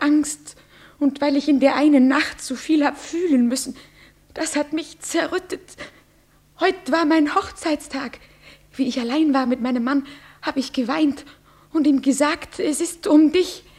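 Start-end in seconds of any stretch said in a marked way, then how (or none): 2.6-13.6 louder, higher, rushing confession; agitated outpouring. Acted. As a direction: spill it out in a rush. Agitated confession of wedding night. (erregt und hastig erzählt)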